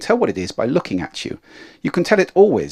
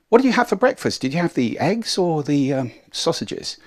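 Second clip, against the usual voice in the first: leading question; 5 semitones higher than usual. Shown as a demonstration. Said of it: "offering little suggestions".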